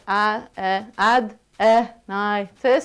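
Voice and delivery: "adenitis" broken into separate syllables. Shown as a demonstration. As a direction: sound out each piece of "adenitis" with gaps. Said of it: "the stress is on the ni".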